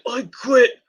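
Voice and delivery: high-pitched acted plea; mock desperate surrender. Wrestler covering his brother, acted desperate surrender.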